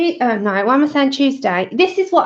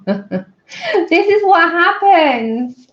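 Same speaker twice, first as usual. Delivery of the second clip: laughing; 4 semitones higher, 3 dB louder, much slower than usual. Real, not acted. Laughing at her own mishap.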